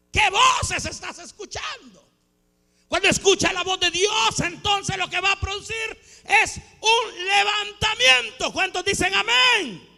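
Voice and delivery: high, ringing exhortation; preaching climax. Real, not acted. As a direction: challenge them, building to a loud shout. (exhortación encendida, en voz alta)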